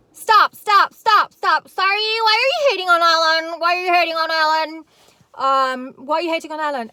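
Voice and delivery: high, loud mock angry outburst; angry girl. Imitating angry girl jumping on the caller, shrill-loud. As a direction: screech at the caller, hammed-up. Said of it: "angry girl comes up".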